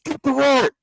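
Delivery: high, loud call down the stairs; yelling. Retold or imitated. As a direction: call out to the crew below. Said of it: "yells back down to the".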